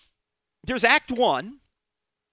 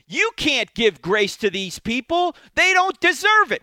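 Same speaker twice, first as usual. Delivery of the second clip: loud, high scornful protest; voicing self-righteous Pharisees, loud and scornful; self-righteous outcry; 8 semitones higher, 10 dB louder than usual. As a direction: shout down the idea of grace.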